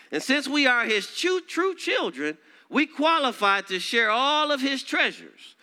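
high, loud, swelling preacher's cry; fiery preacher climax; preaching at full force. Acted. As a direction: lift it up and proclaim it. Preaching surge.

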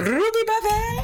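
high questioning echo. Real, not acted. Host echoing co-host's buffet name, high rising query. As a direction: pitch swooping way up.

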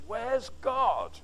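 usual speaking voice

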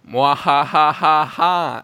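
slow, loud cackling mwahahaha; witch cackle. Acted. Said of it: "That's a thing that witches say".

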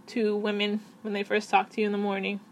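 ordinary talk, nothing marked

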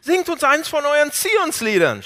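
höher, laut und langsam höhnend; verhöhne die Gefangenen mit einem Liedwunsch; zynischer Spott. Retold or imitated. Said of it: "Wie zynisch". (Eroberer verspotten die Gefangenen, laut und höhnisch)

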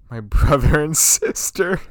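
giggling while thanking family; laughing. Real, not acted. Thanking his brother and sister while laughing.

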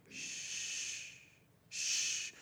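whispered shush; gentle whispered shh; toddler's shush. Retold or imitated. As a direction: hush softly like a small child. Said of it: "she goes".

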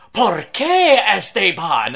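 loud, slow, hammed-up telenovela wail; soap opera drama. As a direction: overplay it like a weeping soap star. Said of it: "They're just so comical, like".

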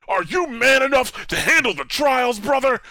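high, loud hyped wrestler promo; monster truck ad. Retold or imitated. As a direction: bellow it like a pumped-up announcer. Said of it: "trying to get people pumped for it".

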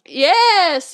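loud, high, drawn-out celebration; triumphant cheer. Real, not acted. Co-host finally yawns, triumphant cheer. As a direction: cheer it out at full volume. Cheering co-host's long-awaited yawn.

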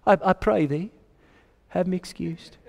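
soft, polite British delivery; British accent. Acted. Preacher voicing the guest's polite excuse, in a British accent. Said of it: "I don't know why I went British on that".

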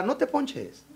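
high, soft, cooing plea; mock consoling. Talking to a flat tire, mock-soothing. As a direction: coo pointlessly as if soothing an object.

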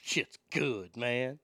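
low, quiet, impressed curse; low impressed mutter. Retold or imitated. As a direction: say it low, like the food's a revelation. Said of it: "you're gonna go".

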